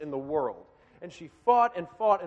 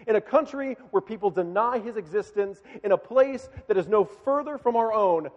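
loud, raised-pitch sermon climax; impassioned preaching; 4 semitones higher, 6 dB louder than usual. Real, not acted. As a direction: preach it forcefully, punching the key words. Preaches at full force.

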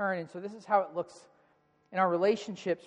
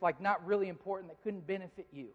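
ordinary delivery

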